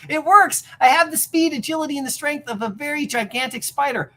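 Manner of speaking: high, loud thrill of discovery; "It works" shouted high and loud with triumph; excited triumph. Acted. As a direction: crow with delight. Peter excited at his power.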